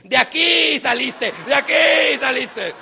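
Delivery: slow, loud bellowed reminder; mother's yell. Retold or imitated. Mother yells at her child, loud and emphatic. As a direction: belt it out, stressing "aquí". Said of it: "esa mujer tan linda y bella que dice".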